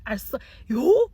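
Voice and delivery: loud, high-rising "yhu"; exasperated cry. Real, not acted. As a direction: cry out in frustration. Exasperated exclamation.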